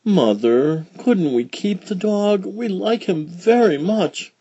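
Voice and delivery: lightly voiced little girl, pleading; little girl begging her mother to keep the dog; young girl voice. A narrator's reading. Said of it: "Fleeka said".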